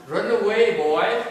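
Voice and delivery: loud, high call with laughter; mock send-off shout. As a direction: gleefully shout the child away. Acts parent cheering child off.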